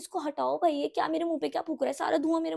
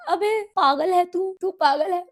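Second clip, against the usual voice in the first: loud shocked yell at a creep; outraged yell; 6 semitones higher, 10 dB louder, slower than usual. Retold or imitated. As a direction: yell it like he's lost his mind.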